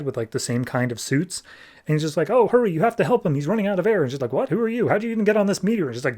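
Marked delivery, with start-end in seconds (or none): 2.3-4.0 high, fast urgent plea. Retold or imitated. 4.3-5.9 high, startled questions; high-pitched what and who are you; surprised question. Retold or imitated. Scientist confused by stranger.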